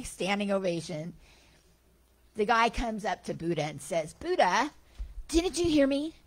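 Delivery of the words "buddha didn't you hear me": high, loud indignant demand; indignant confrontation. Retold or imitated. Heckler confronting the Buddha after the talk, loud high indignation. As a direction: confront him, offended at being ignored.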